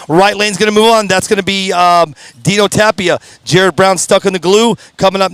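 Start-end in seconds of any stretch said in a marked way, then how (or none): none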